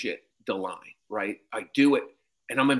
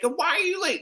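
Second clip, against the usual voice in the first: put-on high, scolding old man voice; lecturing Cosby impression. Comic as Cosby scolding him in front of cast, high lecture. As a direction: wag a finger in a squeaky lecture.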